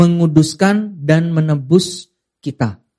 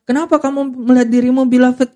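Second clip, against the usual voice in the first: mock questioner; 8 semitones higher than usual.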